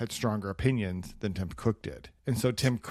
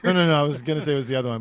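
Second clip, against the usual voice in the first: usual speaking voice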